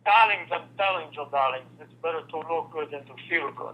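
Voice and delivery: put-on suave accented catchphrase voice; put-on smooth showman impersonation; Fernando. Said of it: "now do Fernando".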